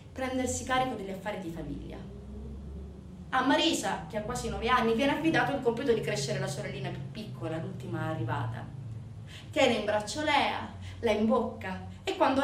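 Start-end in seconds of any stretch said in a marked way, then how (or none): none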